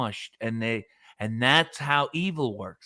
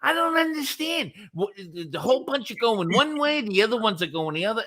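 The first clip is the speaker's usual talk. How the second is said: high, confused waitress voice; lightly voiced puzzled waitress; mocking the waitress; 8 semitones higher than usual. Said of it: "she's like".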